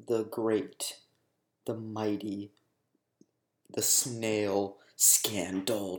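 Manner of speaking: a trailer voice hamming up a silly topic; mock dramatic announcement. Teasing viewers before the big story, mock epic announcement. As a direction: build it up grandly, then drop to a hushed whisper on the scandal. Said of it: "I'm kidding".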